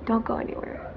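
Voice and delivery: hushed whisper to the viewers; breaking off to whisper an update; whispered aside. Real, not acted. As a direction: whisper a quick hold-on. Aside while someone is at door.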